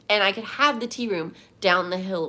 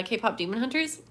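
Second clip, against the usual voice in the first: ordinary delivery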